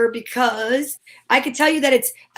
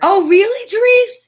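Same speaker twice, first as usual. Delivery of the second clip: high, loud "oh really?"; genuinely taken aback, pitch jumping up; surprised "really?"; 9 semitones higher, 6 dB louder than usual. Real, not acted. Surprised to learn of voice work.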